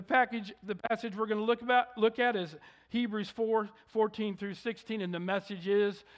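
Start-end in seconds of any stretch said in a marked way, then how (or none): none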